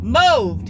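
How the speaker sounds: MOVED hit hard and loud; emphatic moved. Real, not acted. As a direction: slam the word moved down. Scale has not moved, emphatic.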